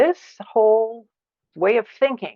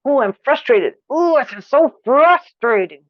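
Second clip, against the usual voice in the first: louder, higher, strained frustration; frustrated; 6 semitones higher, 5 dB louder than usual. Shown as a demonstration. Teacher demonstrating anger that pushes through: strained frustration. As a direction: push through it with effort. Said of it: "I can push through something".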